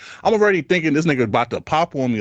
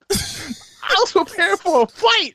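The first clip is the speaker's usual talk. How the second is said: higher and slow, laughing the words out; slower than usual. Real, not acted. Telling his friend he expected a fight, laughing. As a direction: repeat the line while cracking up.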